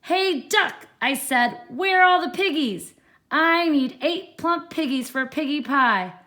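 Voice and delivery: high, loud demanding witch; shouting witch. Acted. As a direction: shout at the duck.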